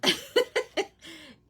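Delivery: high giggle after her own singing; laugh. Real, not acted. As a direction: giggle at yourself. Laughs after her sung bit.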